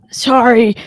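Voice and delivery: loud, clear apology. Real, not acted. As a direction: speak up so you're heard.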